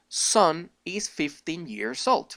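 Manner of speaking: very slow, careful English phrase; slow English demo. Shown as a demonstration. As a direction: model it slowly for learners. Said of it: "se diría".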